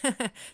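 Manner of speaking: short loud laugh; real burst of laughter. Real, not acted. Laughing while hiding the picture from viewers, bursting into laughter. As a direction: laugh out, a bit embarrassed. Embarrassed about hiding the image.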